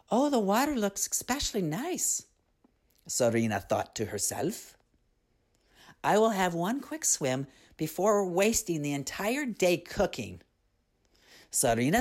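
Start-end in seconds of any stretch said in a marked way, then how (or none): 0.1-2.3 put-on higher girlish voice, dreamy; a girl eyeing the water longingly; young Serena's voice. A narrator's reading. Serena admiring the river, light and dreamy. 6.0-10.4 put-on higher girl's voice, a bit sulky; a girl putting off her chores; young Serena's voice. A narrator's reading. Serena planning one quick swim, lightly girlish.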